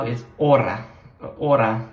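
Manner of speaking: ora said the Italian way, open o, tapped r. Shown as a demonstration. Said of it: "if you say now".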